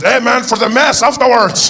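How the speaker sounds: loud, high, drawn-out call; taco vendor. Acted. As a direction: holler it out from the truck. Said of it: "He says".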